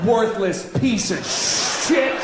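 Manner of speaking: slow hammed-up yell, "Worthless" stretched and rising; mock shout. Comedian acting out yelling in an elevator, mock shout. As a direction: bark it at a stranger as a joke. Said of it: "turn right to their face and go".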